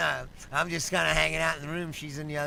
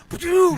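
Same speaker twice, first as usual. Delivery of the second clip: high, loud launch noise; explosive burst of mouth noise; imitated whoosh; 12 semitones higher, 12 dB louder than usual. Dog bolting from the car, loud whoosh noise.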